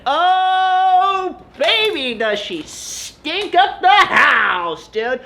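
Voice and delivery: very slow, loud, hammed-up tease; mock dramatic. Vlogger teases fans about his wife, slow and hammed-up. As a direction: drag it out like juicy gossip.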